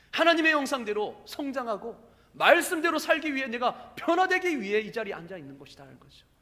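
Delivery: loud, emphatic sermon delivery; forceful preaching. Real, not acted. Preacher explains to church why we sit in worship, forceful preaching. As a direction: drive the point home to the congregation.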